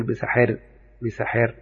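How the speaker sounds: بسحر with the a before ra leaning toward e, "biseher"; بسحر with light imala; small imala. Shown as a demonstration. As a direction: say بسحر with the fatha tilted to kasra. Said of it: "يبقى فيها إمالة صغرى، أقول".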